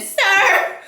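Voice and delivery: loud, high mock indignation; exaggerated retort. Woman confronting the Rastaman, exaggerated loud retort. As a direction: hit it high and loud, overdone. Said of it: "I didn't say it like that".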